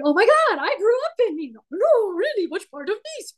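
high-pitched, gushing surprise; acted excited recognition. Two strangers find they share a hometown, excited recognition. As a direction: gush as if finding someone from home. Acted meeting of fellow Nice natives.

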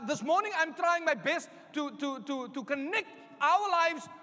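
normal talking voice

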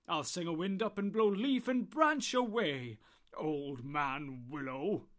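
put-on loud, slow, commanding old man; booming Tom. Tom Bombadil vowing to sing the willow down. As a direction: threaten the tree with jolly power.

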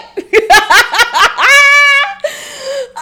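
high helpless laugh; big laugh. Real, not acted. Laughing over the meme, helpless laugh. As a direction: laugh it out loud. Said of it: "I gotta laugh every time".

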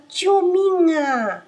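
high, slow frightened cry; hammed-up terrified scream; scared cry for help. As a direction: lamb terrified in the wolf's grip. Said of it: "羊咩咩吓得大声叫：".

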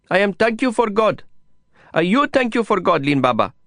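put-on high little boy's voice, broken English; small boy. A narrator's reading. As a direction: voice a small child, high and earnest.

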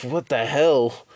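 ordinary delivery

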